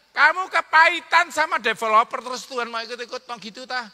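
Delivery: loud, high teasing cry; mocking outburst. Real, not acted. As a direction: throw it at them in mock disbelief. Preacher mocking listener's bitterness.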